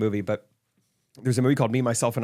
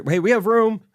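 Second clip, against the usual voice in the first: higher, louder call across the rigs; 8 semitones higher, 4 dB louder than usual. Retold or imitated. Offering seats to the crammed rig, calling out. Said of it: "so we're like".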